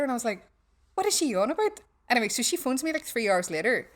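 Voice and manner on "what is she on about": baffled. Retold or imitated. Baffled, pitch jumps.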